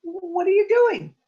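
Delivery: surprised Senior Snake voice; alarmed old snake. Acted. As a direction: call out to the returning snakes in alarm. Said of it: "he said".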